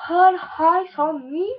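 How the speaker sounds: All Eyes on Me in French-accented English, slow and loud in one sweep. Real, not acted. Girl naming her mascara to viewers, slow, loud French-accented English. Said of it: "Excusez-moi pour mon accent".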